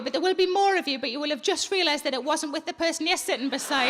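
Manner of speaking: ordinary talk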